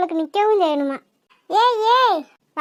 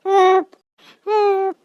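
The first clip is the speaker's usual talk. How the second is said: burping; much slower than usual. Acted. Family member burps after a crab feast, full belly. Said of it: "என்ன பூரா ஏப்பமா விட்டு தள்ளுறீங்க".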